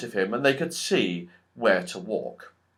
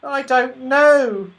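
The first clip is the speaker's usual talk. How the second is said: much higher, slow, sad little squirrel voice; 13 semitones higher, 8 dB louder, slower than usual. A narrator's reading. The squirrel admits sadly he cannot help, high and slow. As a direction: sigh it out, slow and helpless. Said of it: "said the squirrel sadly".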